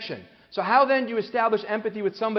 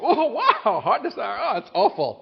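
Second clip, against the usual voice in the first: much higher, gushing oh wow; mismatched excitement; 6 semitones higher, 3 dB louder than usual. Retold or imitated. Listener to heart attack story: hammed-up mismatched gushing. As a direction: react way too brightly to bad news. Mismatched emotion, overdone reaction.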